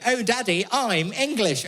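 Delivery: put-on high-pitched little English kid; English-accented child. His imagined child coming up to Daddy, high English accent. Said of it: "they'll probably have English accents".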